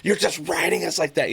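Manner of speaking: put-on high whiny teenage complaint. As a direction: mimic a sulky kid pushing back. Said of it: "Scout's like".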